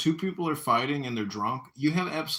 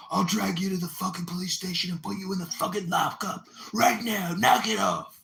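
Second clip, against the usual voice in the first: imitating a furious dad, higher-pitched yell; dad screaming; 8 semitones higher, 3 dB louder than usual. Dad screaming at a guy he has in a headlock, screaming. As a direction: scream a threat like an angry father. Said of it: "started screaming at you".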